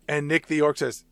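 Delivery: normal talking voice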